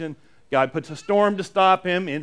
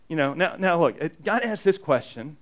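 usual speaking voice

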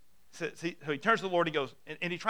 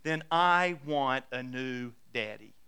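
put-on slow, lowered, deliberate child's ultimatum; imitated child's solemn threat; defiant little girl; 4 semitones lower, slower than usual. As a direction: spell it out like a stubborn toddler. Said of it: "what she says at that moment".

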